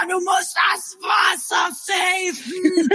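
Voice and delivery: high drawn-out scolding cry; stern relative. Retold or imitated. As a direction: cry out to save the water. Relative rushing to save water.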